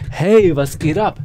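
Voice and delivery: loud, drawn-out greeting off the sweet spot; undisciplined mic demo. Shown as a demonstration. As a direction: greet slowly and loudly, careless of the microphone. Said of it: "wenn ich dann keine Disziplin habe".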